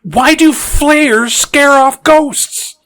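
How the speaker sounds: slow, high, drawn-out incredulous question; exasperated. Acted. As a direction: stretch every word in mounting exasperation. Exasperated questioning of plot holes.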